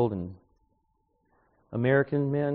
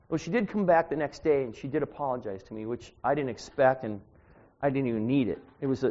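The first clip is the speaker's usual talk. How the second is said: ordinary talk, nothing marked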